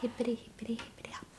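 playful whisper. Pretend. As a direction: breathe it out teasingly. Playful echo of the genre name.

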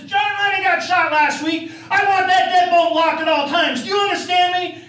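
high, loud ranting demand; yelling. Retold or imitated. Dave ordering his roommate, loud and high. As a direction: bark the order at him.

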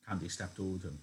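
plain reading voice

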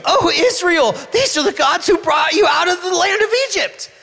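high, loud, shouted proclamation; excited exclaiming. Acted. People hailing the golden calf, high excited shout. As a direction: hail the calf like a cheering crowd. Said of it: "they exclaimed".

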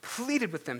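normal talking voice

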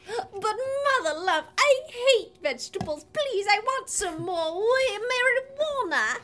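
put-on theatrical childlike pleading; lightly voiced whining child. Child objecting to vegetables, mock whine. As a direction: whine the request pitifully.

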